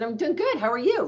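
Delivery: high-pitched bubbly greeting; super bubbly. Retold or imitated. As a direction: imitate a bubbly friend answering the phone. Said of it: "she was super bubbly".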